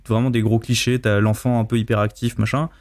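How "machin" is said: normal talking voice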